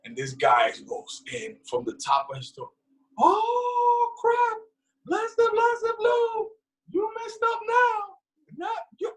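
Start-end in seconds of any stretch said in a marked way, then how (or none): 3.2-9.1 mock drill yell, pitched way up; hammed-up drill instructor yell. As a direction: shriek it like a panicked bus driver. Bus driver yelling at recruits.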